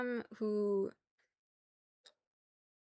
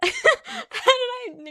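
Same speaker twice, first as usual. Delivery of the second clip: high laughing protest; squealing laugh of disbelief; 14 semitones higher, 11 dB louder, much slower than usual. Real, not acted.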